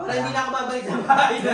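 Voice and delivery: fast words into a long laugh. Real, not acted. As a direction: laugh through the line. Laughing about being pranked.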